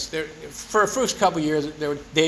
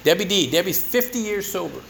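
ordinary delivery

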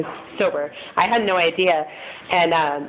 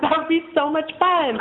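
mock excited; 10 semitones higher than usual. Friends mocking drunk AA plan.

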